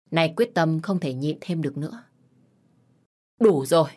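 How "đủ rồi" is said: louder, cutting it off; sharp loud stop. Acted. Wife cutting off her husband's insults, loud and sharp. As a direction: shut him down hard. Mother finally snaps.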